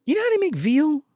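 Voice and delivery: high sinister mother; high sly mother. Retold or imitated. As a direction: lead him on like a creepy mom. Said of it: "She's like".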